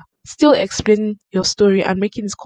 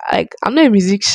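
usual speaking voice

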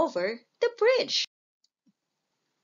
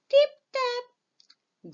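light tip tap; 14 semitones higher, 3 dB louder than usual. A narrator's reading. Little goat's light footsteps.

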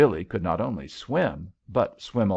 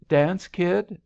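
cryptic invitation, pitched high and louder; 10 semitones higher, 5 dB louder than usual. A narrator's reading. Young man at the dance asks Billy, cryptic and high. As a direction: toss it out high and loud, giving nothing away. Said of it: "said the boy cryptically".

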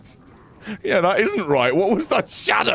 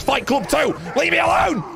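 frustrated shout; 7 semitones higher than usual. Real, not acted. Frustrated outburst at game.

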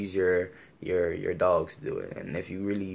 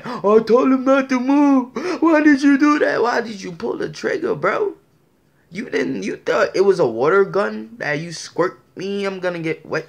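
high, loud whiny fake-tearful cop; mock crying officer; 13 semitones higher, 11 dB louder than usual. Mocking an officer who cries after shooting: high whiny mock sob. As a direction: ham up a sobbing officer's excuses. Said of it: "And they're crying".

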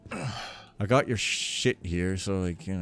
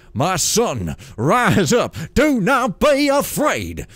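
loud, rising preacher-like proclamation; booming divine lord; 10 semitones higher, 9 dB louder, much slower than usual. Acted. Builder to kneeling poor novice, booming proclamation. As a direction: build to a high, slow, ringing command.

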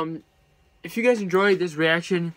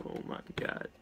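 whispered oh my god; 14 dB quieter than usual. Real, not acted. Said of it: "reacting in disbelief".

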